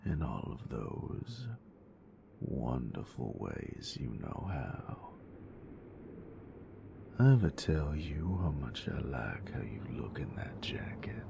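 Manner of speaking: soft, close, teasing whisper to a lover; intimate whisper. Acted. Teasing her nerd with lights, close intimate whisper. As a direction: whisper it close, possessive and playful.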